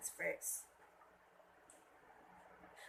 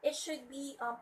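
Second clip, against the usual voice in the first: ordinary talk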